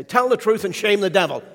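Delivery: a mother insisting on her proverb; mother's saying. Retold or imitated. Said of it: "she'd always say".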